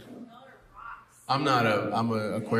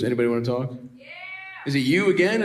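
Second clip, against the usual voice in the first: ordinary talk, nothing marked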